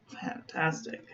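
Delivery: an unvoiced, admiring whisper; soft whispered approval. Real, not acted. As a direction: breathe it out in awe. Admiring ink splotch, whispered.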